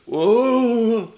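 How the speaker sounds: high, loud mock crowd whoa; imitated audience whoa. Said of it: "like this, you see".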